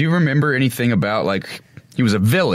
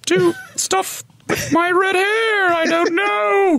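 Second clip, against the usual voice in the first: very high, slow, lofty woman's voice; high, slow, hammed-up heroine voice; commanding lady voice; much slower than usual. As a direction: play the warrior woman, grand then lost. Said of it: "they always kind of talk like this".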